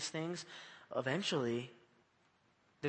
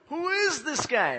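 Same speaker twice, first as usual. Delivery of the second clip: loud, high, drawn-out mock amazement; shocked; 11 semitones higher, 7 dB louder, slower than usual. Disciples baffled by Jesus, mock shocked. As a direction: act out slow, stunned disbelief. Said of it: "they were so shocked".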